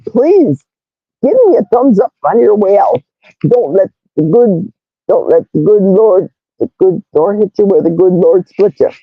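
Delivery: ordinary talk, nothing marked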